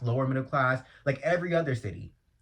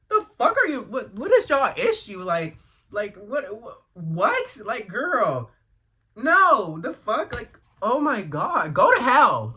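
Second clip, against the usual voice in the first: shrieking, much higher and louder, incredulous rage; furious outburst; 11 semitones higher, 8 dB louder, slower than usual. Real, not acted. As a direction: explode at them, half laughing in disbelief. Outraged rant at religious commenters.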